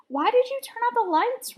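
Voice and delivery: put-on high, puzzled kid question; high surprised question. A narrator's reading. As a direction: pitch it up, baffled. Ryan asks in the dark.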